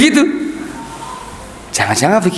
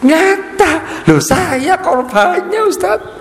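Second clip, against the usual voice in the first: hurt complainer; 14 semitones higher, much slower than usual. Acted. Student complaining to the ustaz about being scolded, high whiny voice.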